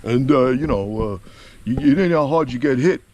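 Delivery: slurred movie-boxer impression; Rocky. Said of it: "he talks like this".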